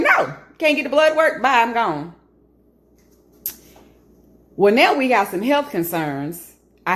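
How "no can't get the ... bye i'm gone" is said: dismissive brush-off. Retold or imitated. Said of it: "So it's like".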